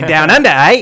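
a loud put-on Australian "Down Under, eh?"; mock Australian twang; mock Australian accent. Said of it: "So I'm gonna talk like this".

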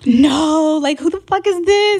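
high, disbelieving outburst; shocked outrage. Retold or imitated. Girlfriend overhearing his lie, high shocked outrage.

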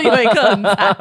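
repeating it with a burst of laughter; laughing repeat. Retold or imitated. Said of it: "他说".

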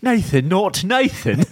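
slow, high mock British; British accent. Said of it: "Uh, British".

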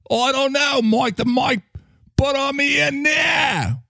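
high, drawn-out Cockney-pirate voice; mixed Cockney accent. Acted. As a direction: bellow it out, very slow at the end. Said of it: "Australian, Cockney, or pirate".

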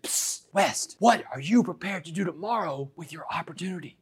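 hushed whisper, no voice at all. Retold or imitated. Cellmate leaning down from the top bunk after lights out, whispering. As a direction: breathe it like a secret after lights out.

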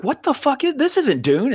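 high-pitched retold bewilderment; astonished disbelief. Fan reliving first sight of the extended cut, astonished. Retelling his first baffled viewing.

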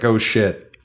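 usual speaking voice

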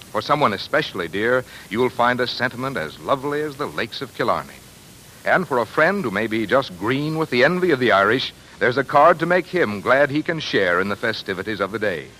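normal talking voice

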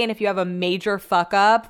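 ordinary talk

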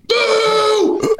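boo him off the ice like seventeen thousand fans; huge crowd boo. Retold or imitated. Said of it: "seventeen thousand people".

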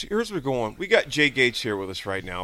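ordinary talk, nothing marked

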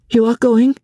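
Marguerite high and hurried, anxious; quick, feverish. A narrator's reading. Wife to departing husband, quick and feverish. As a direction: rush it out, fevered and pleading. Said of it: "she said, quickly and feverishly".

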